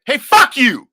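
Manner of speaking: yelled high and loud; retold furious shout. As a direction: blow up at him, full volume. Son blows up at father.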